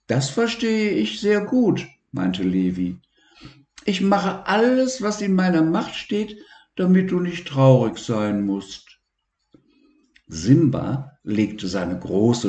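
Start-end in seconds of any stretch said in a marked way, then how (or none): 0.1-1.9 put-on much higher, light child's voice; childlike boy voice. Narrator voices the boy Levi. 3.9-9.0 put-on much higher, light child's voice; childlike boy voice. Narrator voices the boy Levi.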